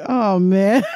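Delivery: laughing in defeat; rueful laughing. Real, not acted. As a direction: crack up at your own letdown. Retold letdown breaking into laughter.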